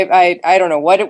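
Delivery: ordinary talk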